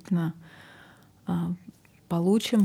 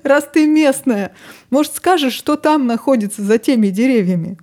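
wizard asking; 6 semitones higher, 6 dB louder than usual. Retold or imitated. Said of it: "волшебник спрашивает".

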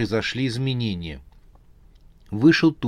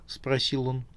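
usual speaking voice

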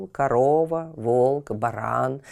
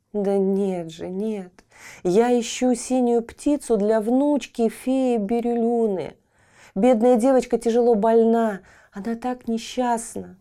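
put-on young boy; 7 semitones higher than usual. Boy pleads.

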